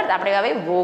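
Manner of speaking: normal talking voice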